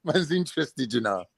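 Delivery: London street slang voice; laid-back ends slang delivery. Acted. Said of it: "It's not like I jumped on and went".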